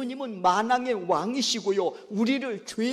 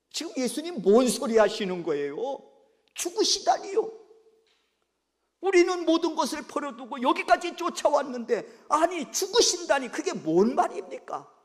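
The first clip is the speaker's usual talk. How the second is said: higher, louder indignant protest; Peter protesting; 6 semitones higher, 3 dB louder than usual. Acted. Peter arguing against Jesus' talk of death, indignant. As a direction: push back in disbelief. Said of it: "드러내놓고 항변했다고 했습니다".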